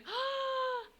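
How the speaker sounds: high, drawn-out gasp; excited gasp. Real, not acted. Streamer reacting to a resub, excited gasp. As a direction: suck in a big high gasp. Gasp after hailing subscriber.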